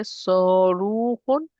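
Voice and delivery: Saruhun very slowly, long held "ruu"; stretched long vowel. Shown as a demonstration. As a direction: draw out both vowels of Saruhun. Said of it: "There's mud here".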